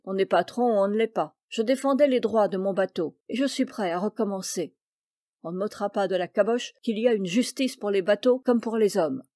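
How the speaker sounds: plain reading voice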